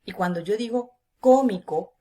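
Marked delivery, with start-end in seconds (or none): 1.2-2.0 cómico punched loud on the first syllable; CÓ-mi-co, voice loaded on the antepenultimate; stress on CÓ. Shown as a demonstration. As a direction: hit the có of cómico hard. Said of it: "cargo la voz en la antepenúltima sílaba".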